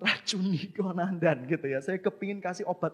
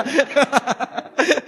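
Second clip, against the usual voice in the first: loud high laugh; burst of laughter; 10 semitones higher, 13 dB louder than usual. Real, not acted. Preacher laughing loudly at his friends' bad advice.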